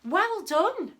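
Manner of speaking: ordinary delivery, nothing marked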